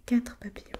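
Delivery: ordinary delivery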